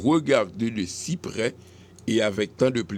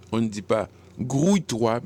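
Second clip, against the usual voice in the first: ordinary talk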